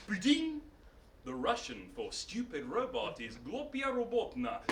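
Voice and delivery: soft robot reciting a translation; blip, then a hushed literal answer; giant robot. Acted. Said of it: "And the giant robot went".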